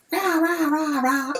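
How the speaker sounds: high-pitched "rah rah" babble; mocking gibberish in a high voice; mock noisy chatter. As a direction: imitate a family yammering on and on. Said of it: "would not shut the fuck up".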